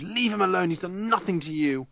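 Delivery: high-pitched defence of a friend, mild scream; screamed protest. A narrator's reading. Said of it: "she screams".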